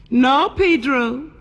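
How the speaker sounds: high, drawn-out no; Rosita refusing. Acted. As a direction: lift and stretch the refusal.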